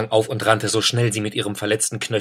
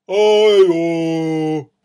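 big booming call, higher and louder; 8 semitones higher, 8 dB louder than usual. A narrator's reading. Bear booms hello at startled Eida, calling loudly. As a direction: bear calls out a hearty hello. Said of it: "rief der Bär".

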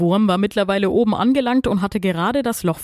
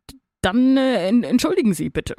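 higher, halting, flustered apology; embarrassed stammer; 6 semitones higher than usual. A narrator's reading. Rooster apologises to supposed knitting needle, embarrassed stammering. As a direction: trip over the first syllables, abashed. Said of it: "stammelte er verlegen".

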